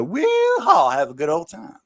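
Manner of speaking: high whooping holler then drawl. Retold or imitated. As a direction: whoop it up like a good ol' boy. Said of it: "you just go".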